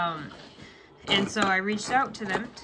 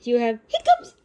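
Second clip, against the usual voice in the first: high, louder laughing coo; 8 dB louder than usual. Real, not acted. Mom teasing hiccuping baby.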